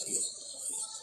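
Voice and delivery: high breathy whisper, as if weeping; whispered crying prayer. Shown as a demonstration. Showing listeners how to cry to Allah. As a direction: let the voice break into a whispered plea. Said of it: "sambil nangis".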